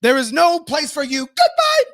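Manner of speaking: high-pitched theatrical dismissal; mock banishment. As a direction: banish them in a squeaky, hammed-up voice.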